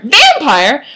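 VAMPIRE punched high and loud, rising at the end; hammed-up scandalized question on "Vampire"; incredulous outburst. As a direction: exclaim "Vampire" in mock disbelief. Repeats the word as outburst.

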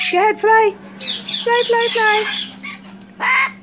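high playful urging; encouraging. Real, not acted. Owner urging her bird to fly, high playful cheer.

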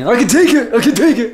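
imitating a tough guy bravely. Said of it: "He's trying to be the tough guy".

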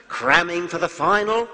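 high, loud attempt at an Australian accent. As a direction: deliver the Aussie punchline, hammy. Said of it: "pardon my attempt at an Australian accent".

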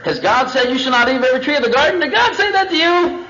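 high, incredulous questioning; feigned disbelief. Acted. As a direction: question it with rising, mock astonishment. Voices serpent questioning Eve.

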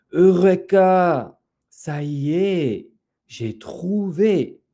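high, drawn-out cry of eureka; triumphant discovery. Acted. Papa Lapin suddenly remembers the peacock's song, triumphant discovery. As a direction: crow slowly over a sudden find. Rabbit cries out his discovery.

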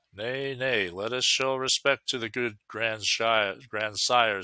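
plain reading voice

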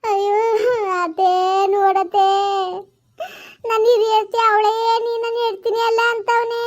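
put-on a young wife wailing her grievance; tearful complaint to mother-in-law about her husband; wronged daughter-in-law.

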